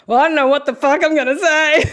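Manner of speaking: cheeky Aussie, exaggerated Australian accent. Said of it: "I've just put on my extra Australian accent".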